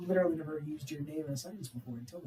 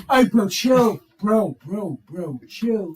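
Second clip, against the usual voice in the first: repeated "bro" with tongue click, settling down; mock plea to chill; catchphrase imitation; 6 dB louder, much slower than usual. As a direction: start loud, ease into "chill". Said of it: "It's the little tongue click for me".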